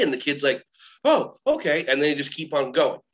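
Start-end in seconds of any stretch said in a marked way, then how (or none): 1.0-1.9 high little "oh, okay"; child pleasantly taken aback; agreeable kid. Acted. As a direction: play a kid giving in, pitched up.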